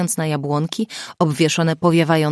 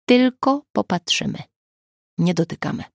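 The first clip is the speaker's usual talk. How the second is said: put-on measured, spaced-out instructions as if to small children; gently condescending, like a nursery teacher; talking down to preschoolers; 3 semitones higher than usual. A narrator's reading. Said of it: "jakby miał do czynienia z przedszkolakami". (pouczająco, jak do przedszkolaków)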